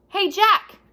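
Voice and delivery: loud, high call. A narrator's reading. Annie calls to Jack from the top of the hill, loud high call. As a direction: call down cheerfully from the hilltop. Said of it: "Annie called".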